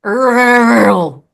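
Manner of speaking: "Rural" with the R's rolled hard and loud; mocking heavy R's. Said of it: "so heavy on the R's".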